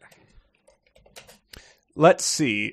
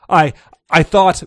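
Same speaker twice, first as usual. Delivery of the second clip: startled stammer, louder and higher; 3 semitones higher, 8 dB louder than usual. A narrator's reading. Aide caught out by his returning boss, stammering. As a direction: trip over the first word in alarm. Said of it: "Brinkerhoff stammered".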